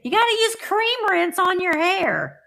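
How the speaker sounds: high mock mom voice; a lightly put-on bossy hairstylist mother; high teasing parent voice; imitating mom. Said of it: "and they would say".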